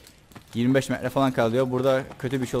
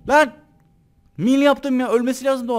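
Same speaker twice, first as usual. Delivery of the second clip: frustrated yelling; 12 semitones higher, 7 dB louder than usual. Real, not acted. Frustrated shouting at game.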